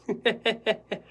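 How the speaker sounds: a short loud laugh in the photographer's voice; hearty laugh. Acted. Photographer laughing about dinner, short loud laugh. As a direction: laugh it off like a pushy newsman.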